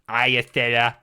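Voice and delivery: goofy mimicking of the contestant; parroting his silly greeting. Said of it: "He's on a silly voice".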